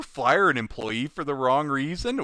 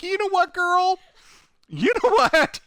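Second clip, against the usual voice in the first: sassy retort; 14 semitones higher than usual. Retold or imitated. Mocking retort.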